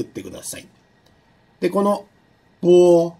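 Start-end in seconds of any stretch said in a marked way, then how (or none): 2.6-3.1 ぼ said crisply and louder with lips firmly closed; two-lip ぼ. Shown as a demonstration. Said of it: "ぽの二つの発音については".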